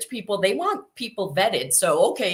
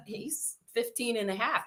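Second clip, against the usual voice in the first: ordinary talk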